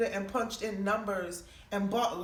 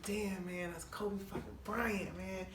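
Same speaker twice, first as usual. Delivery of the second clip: awed under breath; 9 dB quieter, slower than usual. Retold or imitated. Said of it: "I was like".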